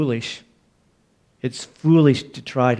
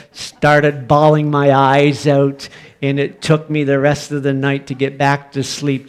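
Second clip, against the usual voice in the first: ordinary talk, nothing marked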